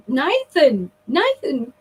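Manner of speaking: mother's astonished call, retold; retold shock. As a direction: call his name as if recalling the shock. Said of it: "tears streaming down my face in absolute shock".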